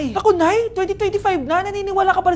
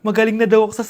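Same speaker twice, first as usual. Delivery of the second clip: lower mother voice; 7 semitones lower than usual. Acted. Mother sharing her good news with her children. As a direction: voice the mother, deeper.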